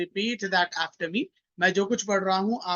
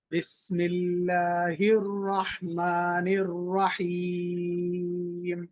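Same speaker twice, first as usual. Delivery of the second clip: Quranic recitation. Shown as a demonstration. Teacher recites for class to repeat.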